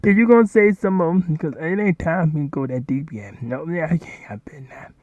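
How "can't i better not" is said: breathy whisper to yourself; secretive whisper, holding back. Real, not acted. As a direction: hush it under your breath. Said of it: "I'm gonna hush".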